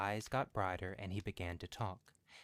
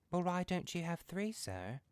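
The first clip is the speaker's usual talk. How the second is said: high, teasing question; cheeky; 10 semitones higher than usual. A narrator's reading. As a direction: tease him cleverly. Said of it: "said Herbert cleverly".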